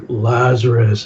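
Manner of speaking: imitating a deep, solemn call. Jesus calling at the grave, deep voice. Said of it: "he does this deep voice like".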